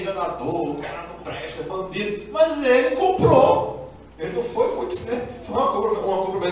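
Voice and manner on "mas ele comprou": "Mas ele comprou" drawn out slowly and loud; slow, louder insistence that he bought it; emphatic slow. Real, not acted. Preacher defending Jacob, slow and loud.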